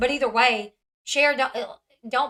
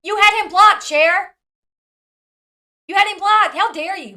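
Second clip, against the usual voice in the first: much louder, higher outraged call-out; loud accusation; 8 semitones higher, 11 dB louder than usual. Real, not acted. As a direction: shout the accusation at Cher, high and loud. Calling out Cher angrily.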